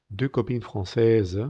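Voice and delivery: careful French, last s of "françaises" silent; silent final consonants. Shown as a demonstration. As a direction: model "deux copines françaises" with endings dropped. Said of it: "las consonantes finales no se pronuncian".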